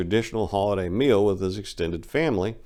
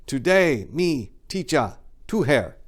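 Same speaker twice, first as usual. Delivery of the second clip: put-on kid's broken English, higher and halting; imitating a student; 6 semitones higher, slower than usual. Middle school kid teasing his teacher in the elevator, higher mock voice. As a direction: pitch it up like a cheeky schoolboy. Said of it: "I hear".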